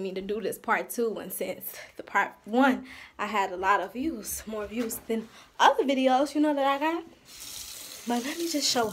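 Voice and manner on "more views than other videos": ordinary delivery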